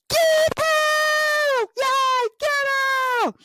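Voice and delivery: rally the crowd to attack; yell. Retold or imitated. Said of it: "somebody yells".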